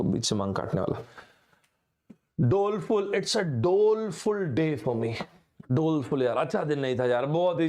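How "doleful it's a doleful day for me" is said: slow, drawn out doleful; example sentence. Shown as a demonstration. Teacher modelling the word doleful, slowly. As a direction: stretch out doleful day slowly. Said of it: "example sentence demo".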